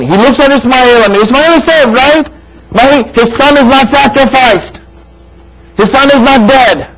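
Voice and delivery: high, loud preaching at full force; impassioned preaching. Acted. Preacher retelling Ibrahim's trial to his friends, impassioned preaching. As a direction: drive the story home to the congregation.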